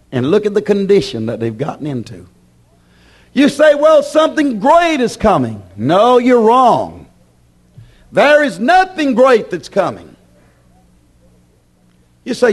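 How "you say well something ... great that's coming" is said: high, forceful pulpit rebuttal; emphatic preaching. Acted. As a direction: knock down the objection from the pulpit.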